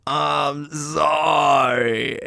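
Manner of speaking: very slow lazy "I'm sorry"; slow, stretched mock apology; drawn-out sorry. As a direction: drawl the apology out the window. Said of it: "stick your head out and go".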